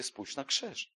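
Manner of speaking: hushed, gentle voice of God; softly, as God pointing to the cross; God speaking softly. Acted.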